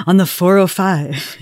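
mock dramatic true crime read; hammed-up creepy newscaster voice; Keith Morrison. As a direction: make a traffic report sound ominous. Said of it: "he didn't read it like".

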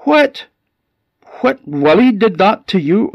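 high, loud outraged disbelief; furious shock. Acted. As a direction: explode in shocked indignation at the news. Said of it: "the old woman was absolutely furious and shocked".

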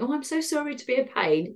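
overly polite British sorry; mock apologetic. Patient apologizes to nurses for shaking, fussy.

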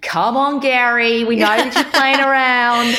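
put-on high, loud Aussie accent; a bouncy Australian accent, slowed. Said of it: "do an Aussie accent like".

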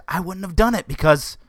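ordinary talk, nothing marked

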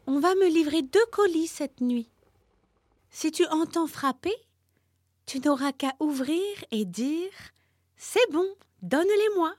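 high, scheming little turtle voice; sly turtle. A narrator's reading. Cunning turtle explains the plan to the warthog, high. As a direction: plot it brightly like a little trickster. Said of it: "Puis la petite rusée ajouta".

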